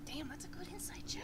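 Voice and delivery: hushed whispered aside. Real, not acted. Game master impressed by the insight roll, whispered. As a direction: breathe it under your breath, impressed.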